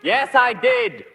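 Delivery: a gentleman shouting directions down to the police. Acted. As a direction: yell the directions so they carry. Shouting, annoyed.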